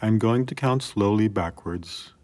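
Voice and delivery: usual speaking voice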